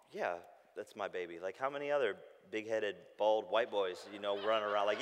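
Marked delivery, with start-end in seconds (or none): 0.1-1.4 relieved reply. Retold or imitated.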